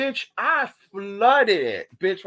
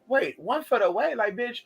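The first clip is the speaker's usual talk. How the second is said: freaked out; 6 semitones higher than usual. Retold or imitated. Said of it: "I was freaked the fuck out".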